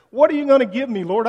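loud, high demand to God; loud frustrated plea; exasperated cry. Acted. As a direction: shout the question up at God, frustrated. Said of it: "almost screams in exasperation".

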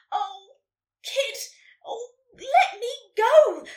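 high, loud, drawn-out demand; building to a slow loud cry; protesting. A narrator's reading. Unicorn caught by the tail, protesting loudly.

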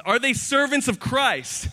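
rhetorical challenge. Acted. Acts Paul's boastful challenge.

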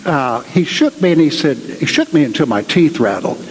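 ordinary delivery

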